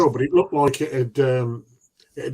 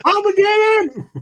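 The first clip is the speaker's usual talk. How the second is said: dramatic shout; 5 dB louder, slower than usual. Pretend.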